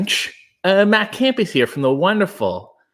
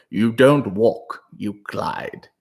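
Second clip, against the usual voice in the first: Michael Caine; 3 semitones lower, much slower than usual. Retold or imitated. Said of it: "Michael Caine played the same role".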